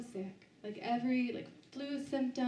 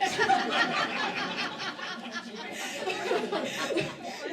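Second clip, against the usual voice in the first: big laugh; 9 semitones higher, 7 dB louder than usual. Real, not acted. Laughing at own near-death story.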